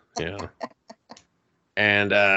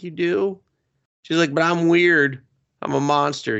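high, hesitant doubt; mock timid girl; 7 semitones higher than usual. Girl doubting she can be his friend, high and timid. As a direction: voice her shy disbelief. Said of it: "she's just like".

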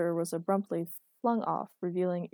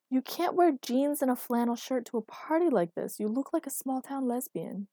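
higher-pitched, fussing objection; exasperated scolding; 6 semitones higher, 3 dB louder than usual. A narrator's reading. Man objects to girlfriend's party outfit, exasperated.

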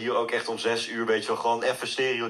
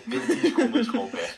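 laughing; 11 semitones higher, faster than usual. Real, not acted. Laughing about food vanishing.

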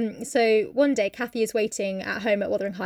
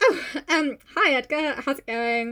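awkward, higher-pitched greeting; flustered; 4 semitones higher, slower than usual. Acted. Kathy greeting Edgar as he arrives, mock flustered. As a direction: start high and settle, mock flustered. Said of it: "Yeah, a bit flustered".

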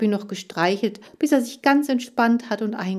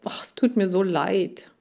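usual speaking voice

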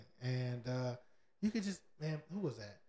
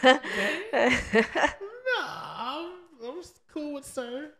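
high-pitched, incredulous comic protest; mock disbelief; 11 dB louder, much slower than usual. Man balks at a kid calling him gang, mock disbelief. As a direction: push the voice way up in amused disbelief.

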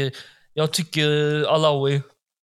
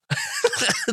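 a very high squeaky laugh. Real, not acted. Amused by his own pun, a high squeaky laugh.

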